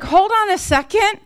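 high-pitched interjection; halting self-talk. Acted. Psalmist interrupting his own thoughts, high and insistent. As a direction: cut in high to halt racing thoughts.

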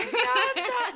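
high, loud laughing delight. Real, not acted. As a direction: burst out laughing with joy. (risa de alegría, aguda)

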